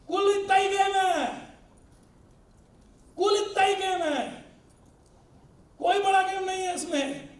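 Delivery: high, slow, loud proclamation; emphatic declaration. Real, not acted. Speaker tells trainees how small the game really is, emphatically. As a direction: drive it home to the hall, high and drawn out. Refrain called out to audience.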